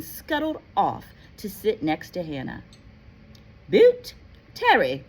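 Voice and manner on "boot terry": loud roll call; calling out a name. Acted. McGonagall calls the next student up, loudly. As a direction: call the name across the hall.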